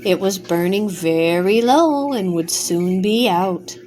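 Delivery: plain reading voice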